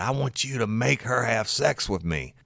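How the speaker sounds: husband insisting to the deputy, loud; demanding husband. Retold or imitated. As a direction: push the demand hard.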